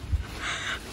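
soft unvoiced giggle; breathless giggle. Real, not acted. Exhausted traveller giggling with relief. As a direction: let out a tiny relieved laugh. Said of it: "I'm so happy".

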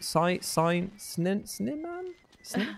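slow, high, unsure of the name; high puzzled drawl. Real, not acted. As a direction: stretch it out, baffled. Struggling to read donor name.

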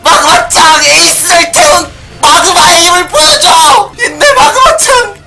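very high, crying-out cheer; frantic cheering. Real, not acted. Gamer screaming encouragement at his kart Magma, high cheer. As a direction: cheer the kart on at full pitch.

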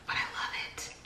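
a soft confiding whisper; whispered aside. Real, not acted. To viewers, admitting her shimmer love in a whisper. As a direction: breathe it like a secret.

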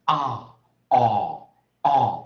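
"啊" and "哦" as a loud, long open ah without R; lengthened vowel, no R. Shown as a demonstration. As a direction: hold the vowel long and leave the R off. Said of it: "的这音延长". (英式长元音，延长不卷舌)

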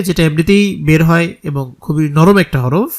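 normal talking voice

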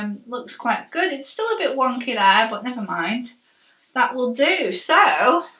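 jaw held by strap; speaking through tight chin strap; wearing a chin strap. Real, not acted.

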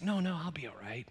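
soft whispered brush-off; hushed reassurance. Retold or imitated. Pastor whispering to his worried colleague, no cause for fear. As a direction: breathe it out, no voice, just air.

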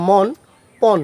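মন, পন with open "aw", "mawn, pawn"; open-vowel pronunciation. Shown as a demonstration. Teacher shows the retroflex-n rule, open vowel in মন, পন. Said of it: "অর মতোই উচ্চারণ হবে".

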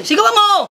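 forceful urging. Acted. Friend urging husband to shout back, forceful urging.

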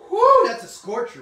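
loud, high delighted whoo; excited whoo. Real, not acted. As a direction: cut loose at the heat of it. Reacting to hot cosplay.

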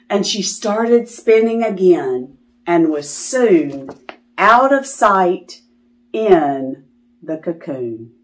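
plain reading voice

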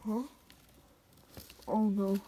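ordinary delivery

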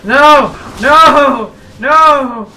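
high, loud repeated no; frantic yelling. Real, not acted. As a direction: yell no in panic. Panicked yelling at game.

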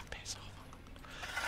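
very soft, whispered grumble; whispered aside. Real, not acted. Player grumbling at the game's demands, whispered. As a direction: breathe it out under the breath.

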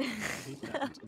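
hammed-up squeaky distaste; mock disgust. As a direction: draw it out like a fussy cartoon.

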